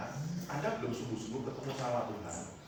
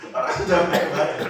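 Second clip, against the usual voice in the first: much louder, laughing through the words; laughing outrage; 4 semitones higher, 14 dB louder than usual. Real, not acted. Preacher to congregation, outraged while laughing, loud and laughing. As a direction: laugh it out in disbelief.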